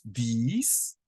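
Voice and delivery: these, said slowly with a rising tune; model pronunciation. Shown as a demonstration. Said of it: "repeat after me".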